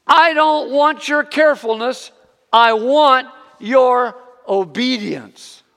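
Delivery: high, loud, slow emphatic scolding; yelling. Retold or imitated. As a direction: shout it, dragging out the last line. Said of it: "she yells out".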